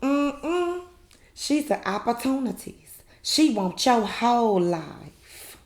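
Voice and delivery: high-pitched imitation of a scornful woman; Marie impression. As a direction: start high, draw out the last words. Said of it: "Marie talking about".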